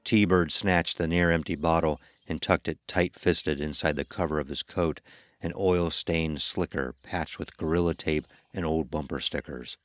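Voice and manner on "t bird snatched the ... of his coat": ordinary delivery, nothing marked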